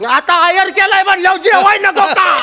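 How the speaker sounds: hammed-up affronted old man; indignant retort. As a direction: defend yourself with outraged questions. Old man defends eating at wedding.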